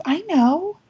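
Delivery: put-on high child's reply; lightly voiced child; little girl. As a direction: answer like a small knowing girl. Girl's answer.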